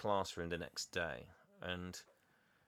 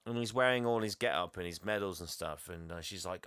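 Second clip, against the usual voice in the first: normal talking voice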